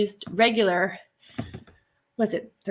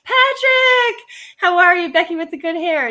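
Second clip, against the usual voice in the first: much higher and louder hello; excited greeting; 9 semitones higher, 7 dB louder than usual. Real, not acted. Greeting Patrick joining the stream, excited and loud. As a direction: call out to a friend arriving.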